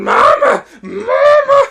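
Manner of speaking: loud, rising cries for mother; wailing little sister. Retold or imitated. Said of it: "the other girl, I guess his sister".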